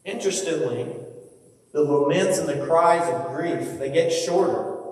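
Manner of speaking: usual speaking voice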